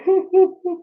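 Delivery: a quick high little laugh; short laugh. Real, not acted. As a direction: laugh briefly to yourself. Amused pause while talking.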